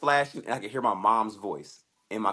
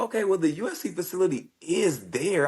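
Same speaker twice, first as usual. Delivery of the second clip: higher, building realization; dawning excitement; 8 semitones higher than usual. Retold or imitated. Fighter realizing the UFC gym is there, high excited. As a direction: reason it out with rising interest.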